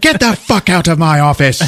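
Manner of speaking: high, angry yell; furious chief. Retold or imitated. Chief ordering Stone out of his office, furious. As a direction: imitate a boss throwing someone out.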